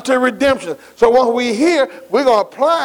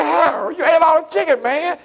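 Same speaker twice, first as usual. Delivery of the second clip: higher-pitched incredulous complaint; mock protest; 8 semitones higher than usual. Preacher retelling his complaint to a lunch friend, mock-indignant and high-pitched. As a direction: protest in playful disbelief, voice lifted high.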